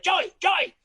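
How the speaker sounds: very high, panicky calling imitation; mock cry for help. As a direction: call for your friend in pretend panic. Acts out calling friend for help.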